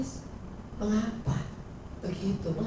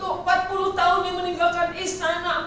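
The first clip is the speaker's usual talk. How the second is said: ordinary talk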